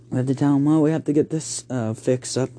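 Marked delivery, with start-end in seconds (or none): none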